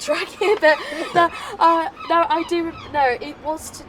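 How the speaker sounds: loud laughter through the words; laughing. Real, not acted. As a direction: laugh at your own lost thread. Loses track, laughing at himself.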